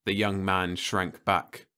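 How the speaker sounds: ordinary delivery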